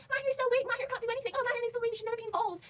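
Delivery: high, whiny mock quote; mocking imitation. Fan mimicking Tom belittling the hero, high and mocking. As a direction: parrot the villain in a silly high voice. Mocking what the villain kept saying.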